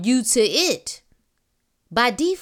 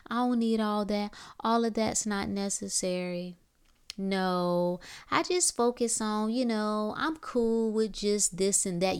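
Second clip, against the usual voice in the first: softer, dismissive imitation of a lame excuse; mock self-excuse; 7 dB quieter than usual. Mocking the lies we tell ourselves, soft and dismissive. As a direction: brush it off like a half-hearted excuse to yourself. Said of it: "silly little stuff like".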